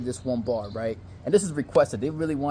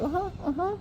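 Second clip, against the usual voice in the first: high, soft squeaky agreement; mock female voice; 5 dB quieter than usual. Girl agreeing to her date's warning, mock high female voice. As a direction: imitate a compliant girl, very high and light.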